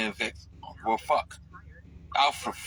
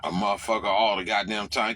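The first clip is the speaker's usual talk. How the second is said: ordinary talk, nothing marked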